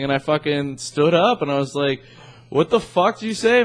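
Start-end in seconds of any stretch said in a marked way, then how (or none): 2.5-3.6 high-pitched, unaggressive challenge; retold puzzled protest; not mean. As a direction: ask it incredulously without any meanness. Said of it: "I wasn't even, like, being, like, a mean tone".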